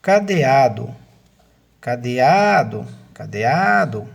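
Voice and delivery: "cadeado" loud, with the A after "de" drawn out; cadeAAAdo, emphatic third syllable; stress on the A. Shown as a demonstration. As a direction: stretch the "a" before "do" in "cadeado". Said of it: "a sílaba tônica é o a".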